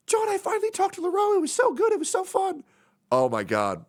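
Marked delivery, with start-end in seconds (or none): 0.1-2.6 put-on very high, quick, excited girl voice; Sophia. Friend reporting she finally talked to him, high giddy voice. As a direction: squeal it like a thrilled friend. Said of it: "she was like".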